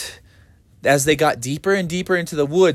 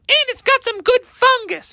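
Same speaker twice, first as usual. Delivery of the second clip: put-on high squeaky kid voice; cheeky kid voice. A cub teases Mother about the fungus, high and squeaky. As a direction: pipe up high like a little cub. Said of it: "someone shouted out".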